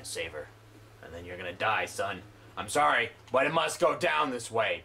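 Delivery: loom over the enemy like a hulking brute; mock menacing Big Daddy rumble; deep monster voice. Player voices a menacing game foe.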